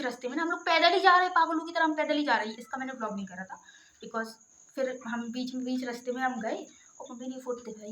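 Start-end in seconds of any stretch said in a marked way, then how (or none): none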